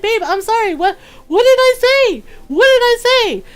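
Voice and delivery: high-pitched bewildered pleading, acted out; panicked pleading. Boyfriend begs angry girlfriend for an explanation, mock panic.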